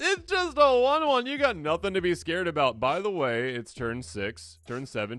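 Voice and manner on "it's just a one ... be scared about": exaggerated mock innocence. To opponents, faking innocence about the creature. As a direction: stretch out "one one" like a sweet lie.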